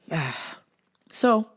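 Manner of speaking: ordinary talk, nothing marked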